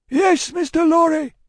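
high, loud answering holler; calling back. Acted. Jerry answering Lorry through mist, loud call. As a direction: shout a reply across the distance.

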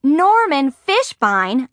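high, loud, drawn-out disbelieving yell. A narrator's reading. Margaret discovers who sent the invitation, incredulous yell. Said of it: "I yelled".

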